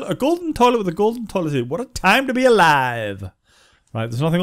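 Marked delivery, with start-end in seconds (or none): none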